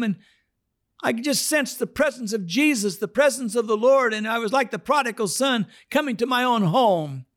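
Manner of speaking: mock high elated Fred; high-voiced Fred. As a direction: testify high and thrilled. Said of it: "now he doesn't talk like this".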